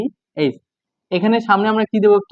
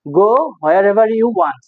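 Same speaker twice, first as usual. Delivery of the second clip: "go wherever you want" spaced out word by word, slowly; slow dictation; much slower than usual. Shown as a demonstration. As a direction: say it slowly so students can follow. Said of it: "মানে কি, কি বললাম?".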